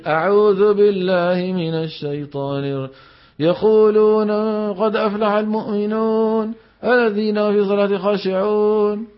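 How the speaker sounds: slow, lips-unrounded lazy recitation; lazy reciter. Shown as a demonstration. Imitating a lazy student reciting without rounding the lips. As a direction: mimic a lazy reader with no lip rounding. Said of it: "كسلان هذا".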